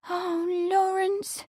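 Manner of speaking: high, slow, sighing maid. A narrator's reading. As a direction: sigh his name with longing. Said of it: "Amelia sighed".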